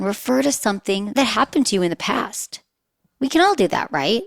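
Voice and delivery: normal talking voice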